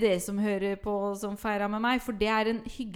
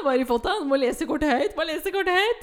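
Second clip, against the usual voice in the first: pushy, rapid repeated urging, raised pitch; mock eager guests; 6 semitones higher, 5 dB louder than usual. Guests pressing her over gift and card, mock-eager. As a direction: imitate nosy party guests egging her on.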